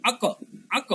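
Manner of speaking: আক with the k pushed off into an echo; qalqalah echo. Shown as a demonstration. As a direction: bounce the final k of আক. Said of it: "এরকম একটা উচ্চারণ". (আক-এর ক ধাক্কা দিয়ে উচ্চারণ)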